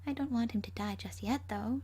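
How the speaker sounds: plain reading voice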